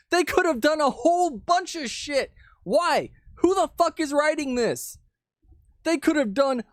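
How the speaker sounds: high-pitched, exasperated disbelief; real exasperation at a high pitch; exasperated outburst. Real, not acted. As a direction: rant it, pitch jumping way up. Exasperated rant after reading the article.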